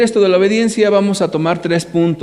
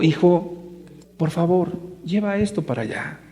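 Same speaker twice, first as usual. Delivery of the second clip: softer, coaxing father's plea; gentle father; 5 dB quieter than usual. Pretend. Father coming close to coax his defiant son, soft gentle voice. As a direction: plead gently, almost tenderly, with the boy. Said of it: "Entonces yo me acerco como papá y le digo".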